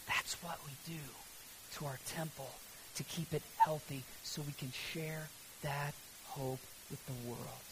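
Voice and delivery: soft, low sermon close, near a whisper at times; hushed preaching. Real, not acted. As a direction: let the sermon settle into quiet conviction.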